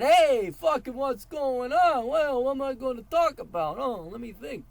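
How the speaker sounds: high, loud, slow rambling of a speaker with no plan; mock a guy fumbling on stage without notes; clueless unprepared speaker. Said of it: "you just can't come up there and".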